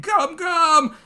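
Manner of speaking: high, punchy hero shout; attack call. Acted. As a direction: belt out the move name high. Acting Luffy's attack call.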